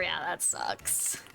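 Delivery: soft slow whisper; whispered aside. Real, not acted. Streamer answering chat about rude people, slow whisper. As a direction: breathe it under your breath.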